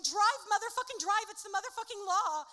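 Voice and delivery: frantic high scream, fast at the end. Acted. Ike yelling at the driver to flee, screaming. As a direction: yell it like the cops are right behind. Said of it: "Ike jumps in the car screaming".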